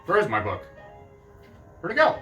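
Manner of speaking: ordinary delivery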